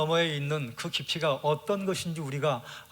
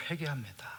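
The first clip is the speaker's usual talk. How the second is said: whispered confession, voice dropping away; 9 dB quieter than usual. Acted. Job whispering his repentance to God. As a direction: breathe the repentance out as a hushed whisper.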